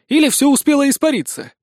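high-voiced speculation; offering a guess. A narrator's reading. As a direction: pitch it high, floating a theory. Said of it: "предположил Сисюля". (высоко, с предположением)

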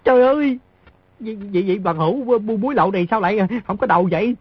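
high, mock-crying complaint; fake crying. Boy mock-cries after the smugglers are killed, teasing fake crying. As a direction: whine high in pretend distress. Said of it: "Đứa nhỏ khóc nói".

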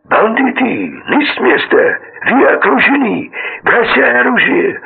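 put-on high, frantic yell in a Georgian accent; hysterical Georgian shout. A narrator's reading. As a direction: scream it in panic with a Georgian accent. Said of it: "он истерично закричал, выявляя грузинский акцент".

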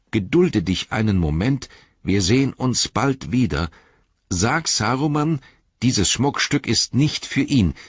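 high, commanding voice; quoted dark lord. A narrator's reading. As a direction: hand down the order imperiously. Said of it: "aber er hat gesagt". (gebieterisch, mit erhobener Stimme)

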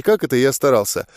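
ordinary delivery

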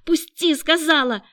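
high, loud order to let go; high-pitched forceful protest; desperate demand. A narrator's reading. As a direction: cry out sharply while fighting free. Struggling against attacker.

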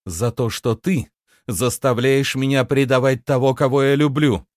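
high, impassioned reproach; bitter reproach. A narrator's reading. Bitter answer to her captor. (с горьким упрёком, громко)

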